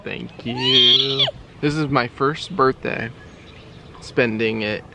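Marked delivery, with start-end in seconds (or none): 0.0-1.3 very high, louder squeak; squeaky high voice. Pretend. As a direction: answer in a tiny high voice.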